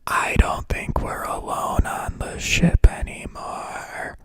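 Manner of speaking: slow, shaky crying whisper; fearful whisper. Acted. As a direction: breathe it out in dread.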